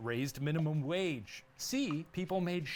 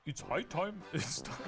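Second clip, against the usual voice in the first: a mock British accent that slides toward German; botched British accent; slower than usual. Host attempts the quote in a British accent, badly. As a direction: try a posh British accent and miss it. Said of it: "Is that German?".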